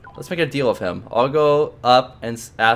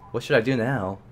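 ordinary talk, nothing marked

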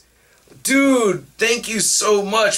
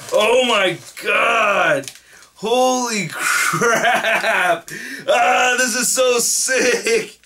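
slow, drawn-out cries of real delight; stunned happy shouting over a gift; excited outburst; 4 semitones higher, much slower than usual. Real, not acted. Unboxing a custom figure of himself.

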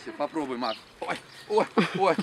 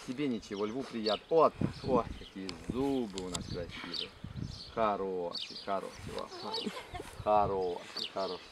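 soothing; 6 semitones lower, 4 dB quieter than usual. Real, not acted. Soothing the lion for photo.

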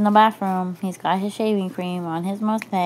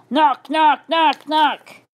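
high, loud knock knock calls; mock knocking; 9 semitones higher, 8 dB louder, much slower than usual. Storyteller voicing a knock at the door, high and loud. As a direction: rap out a knock in a squeaky voice. Knocking sound effect voiced.

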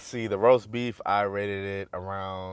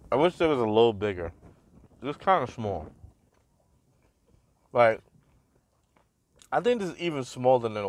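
speaking between bites of slider; talking while chewing. Real, not acted. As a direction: mouth partly full of food. Seen 1: bit at -5s, chewed, holds slider while talking after.

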